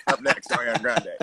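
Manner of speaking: mock DJ announcing next song; radio DJ voice. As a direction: play the radio host. Radio DJ parody.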